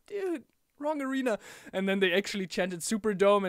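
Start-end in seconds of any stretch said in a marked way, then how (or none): 0.1-1.4 higher, deadpan correction; a fan setting him straight; mock crowd voice, higher. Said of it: "everyone's like".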